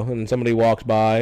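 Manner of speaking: ordinary talk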